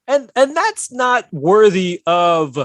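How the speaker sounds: slow, high, loud protest; loud emphatic. Real, not acted.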